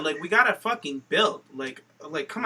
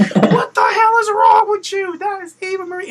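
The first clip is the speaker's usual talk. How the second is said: mock outrage; 9 dB louder than usual. Host imitating commentator defending Eva Marie, mock outrage. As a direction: holler it like a scandalized commentator.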